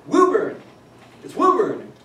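Woburn with an OO, correcting the tourist; cab driver correcting. Retold or imitated. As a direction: cabbie insisting on WOO-burn. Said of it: "And he said".

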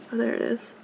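usual speaking voice

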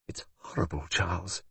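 very soft, shocked undertone; hushed distress. A narrator's reading. Sophia pale after watching the arrest, hushed and distressed. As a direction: say it faintly, in quiet dismay.

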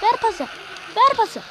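yüksek ve tiz ver pası; sabırsızca pas iste; pas isteyen bağırış. Real, not acted. Takım arkadaşına pas istiyor. (maçta takım arkadaşından yüksek sesle pas istiyor)

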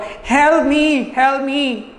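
high-pitched cry for help; elf pleading. A narrator's reading. Teacher voicing the elf asking Patrick for help, high pleading. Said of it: "he always say what".

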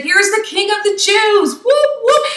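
high, hammed-up hailing of a king; mock crowd cheer. Preacher mimicking the crowd hailing Jesus, mock cheer.

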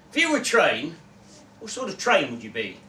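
loud, pushy mate voice; hearty bloke, needling his friend; brash Gary voice. Acted. Gary's brash voice.

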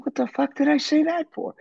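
very high-pitched exasperated squeal; mock self-reproach. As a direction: re-enact kicking yourself for blurting it out. Retelling his horrified inner reaction.

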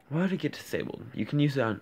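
high-pitched puzzled challenge; incredulous retort. Retold or imitated. Questions the hacker's odd claim.

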